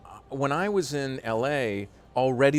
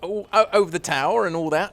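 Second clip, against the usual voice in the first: higher, louder Cockney phrase; Cockney accent; 8 semitones higher, 6 dB louder than usual. Acted. Still demonstrating Cockney for the host, Cockney accent. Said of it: "But Cockney's quite".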